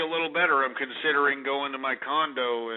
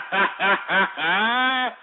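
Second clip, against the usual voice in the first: laugh; 6 semitones higher than usual. Real, not acted. Laughs after opening beer.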